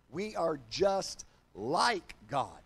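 slow, deliberate, every word drawn out; nice and slow. Shown as a demonstration. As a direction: spell it out slowly for the slow learners. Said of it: "gonna say it real nice and slow".

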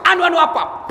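loud, high mocking echo; acted exasperated demand; impatient interrogation. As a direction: snap the stammer back at the suspect. Interrogator throws his stammer back.

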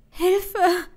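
high, loud cry for help; scream of fear. A narrator's reading. Bound captive screaming for help, terrified. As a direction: cry out in terror. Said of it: "schrie ich aus Angst, so laut ich konnte".

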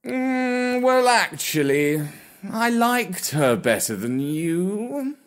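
very slow, lazy aristocratic drawl; unhurried, lordly and bored; snooty bored cat. Acted. Gatsby answers.

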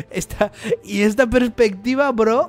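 amused disbelief, laughing out. Real, not acted. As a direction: crack up at the shot. Laughs at the camera angle.

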